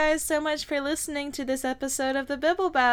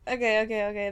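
usual speaking voice

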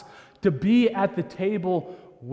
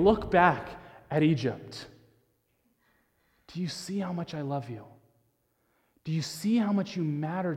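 soft, lowered and unhurried; tender voice of God; 3 semitones lower, 4 dB quieter than usual. Retold or imitated. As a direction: hush it like a loving parent. God speaking love through Jesus.

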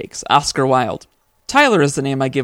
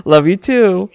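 giggly late night call; 4 semitones higher, 7 dB louder, slower than usual. Pretend. Mock lovey goodbye.

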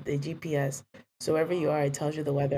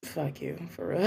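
ordinary talk, nothing marked

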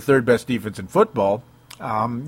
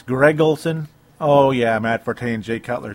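ordinary delivery

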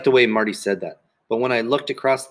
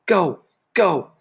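high-pitched yelled urging; insistent yell; 7 semitones higher, 3 dB louder than usual. A narrator's reading. Marty yelling on at exhausted Sheila, insistent shout. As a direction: keep yelling her on.

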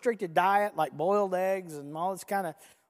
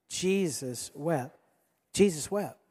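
slow, lower, drawn-out JESUS WEPT; leading recitation; 4 semitones lower, slower than usual. Shown as a demonstration. Preacher has congregation repeat the verse, slow recitation. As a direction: draw it out solemnly for group repetition. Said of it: "One more time".

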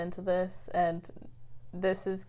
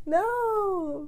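laughing; 7 semitones higher, 6 dB quieter than usual. Real, not acted. Embarrassed giggle at teasing.

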